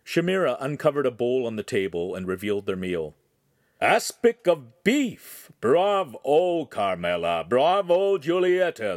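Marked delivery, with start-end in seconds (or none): 3.8-9.0 loud, high, drawn-out praise; crowing. A narrator's reading. Master to the girls as the meal is revealed, crowing. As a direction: crow triumphantly, stretching the words. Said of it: "the master crowed".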